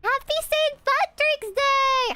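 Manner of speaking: slow, high-pitched festive holler; hammed-up cheer. Streamer returns a Saint Patrick's Day greeting, hammed-up cheer. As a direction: draw it out in a big, high cheer.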